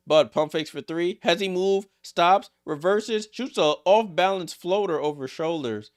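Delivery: loud, high, rapid-fire call; excited play-by-play. Real, not acted. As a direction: ride the action as it happens. Live play-by-play of a drive.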